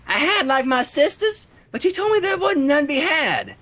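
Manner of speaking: a hint of a young farm filly; lightly voiced Apple Bloom.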